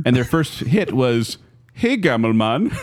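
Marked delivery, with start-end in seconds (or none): 1.7-2.8 put-on Swedish accent. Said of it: "We gotta start doing Swedish pronunciations".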